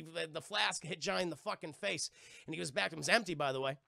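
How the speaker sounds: usual speaking voice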